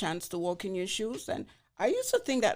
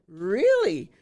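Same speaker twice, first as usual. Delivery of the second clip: loud surprised; 10 dB louder than usual. Retold or imitated. Retells her disbelief again.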